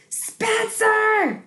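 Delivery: high, loud furious shout; screaming. A narrator's reading. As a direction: scream his name in outrage. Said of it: "she screamed".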